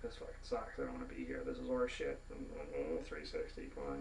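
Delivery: low, soft griping under the breath; muttered grumbling. Retold or imitated. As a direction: grumble quietly to yourself. Acts grumbling all day at work.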